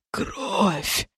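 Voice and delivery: soft, choked, strangled word; quiet strangled. A narrator's reading. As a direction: squeeze it out quietly. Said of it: "тихо и очень сдавленно ответил демон".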